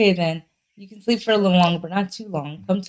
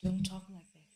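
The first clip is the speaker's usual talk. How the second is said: soft ghostly voice; 16 dB quieter than usual. Acted. Voices answer the scolding.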